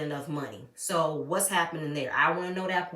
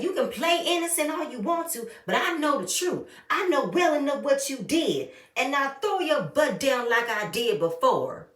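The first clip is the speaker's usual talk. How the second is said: higher-pitched, emphatic mock telling-off; imitated confrontation; 6 semitones higher than usual. Retelling Aunt Nora confronting Kim, in an emphatic challenge. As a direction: call her out, as the riled aunt.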